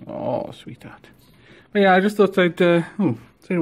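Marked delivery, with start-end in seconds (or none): 0.1-1.1 tender whisper. Real, not acted. Soft tender word to ferret.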